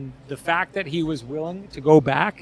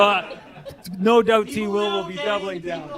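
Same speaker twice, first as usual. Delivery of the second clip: ordinary talk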